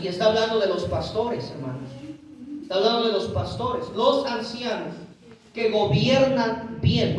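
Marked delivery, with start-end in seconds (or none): 3.9-7.2 "gobiernan" stretched out slowly and hit hard; scripture phrase drawn out slowly with weight on "gobiernan"; slow emphatic reading. Shown as a demonstration. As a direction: lean on "gobiernan bien" word by word, slowly. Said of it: "note esa frase".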